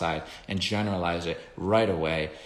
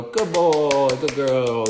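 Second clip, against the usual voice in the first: high, louder happy praise; upbeat praise; 6 semitones higher, 7 dB louder, much slower than usual. Shown as a demonstration. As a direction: reward the dog cheerfully as it comes. Praising the dog at feet.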